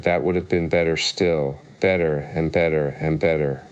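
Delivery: plain reading voice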